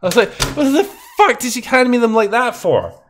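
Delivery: ordinary talk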